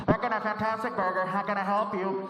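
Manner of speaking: drive-thru speaker. Acted. Burger worker greets customer, crackly speaker voice. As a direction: bark it like a fast-food intercom. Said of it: "They'll come on the speaker".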